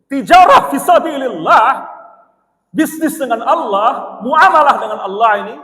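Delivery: loud, high, slow preaching peak; preacher stressing trade with God, loud and slow; fervent preaching. Real, not acted. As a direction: proclaim it with full fervour. Preaching climax.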